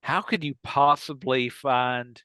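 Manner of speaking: normal talking voice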